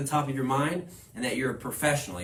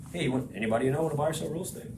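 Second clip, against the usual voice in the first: rushed, rattled-off sales pitch; mock the pushy agent, fast; hurried parody of that guy; pushy salesman; 2 semitones higher, much faster than usual. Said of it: "You're not being that guy of just".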